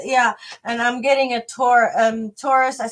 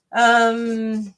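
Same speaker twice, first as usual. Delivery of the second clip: ordinary talk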